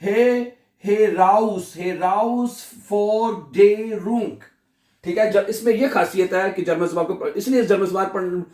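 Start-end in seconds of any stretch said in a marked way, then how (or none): 0.0-4.3 "Heraus Forderung" very slowly, he-raus, for, de, rung; long pauses between the syllables of "Heraus Forderung"; every letter sounded. Shown as a demonstration. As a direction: start with a lone "हे", then build "Heraus Forderung" in drawn-out parts. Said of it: "कोई भी miss नहीं होना".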